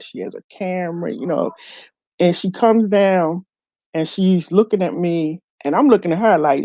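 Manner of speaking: usual speaking voice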